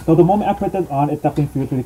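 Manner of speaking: muffled behind mask; speaking inside snorkel mask; through a full face mask. Real, not acted. Seen 0: Full-face snorkel mask worn over the whole face throughout the labelled words.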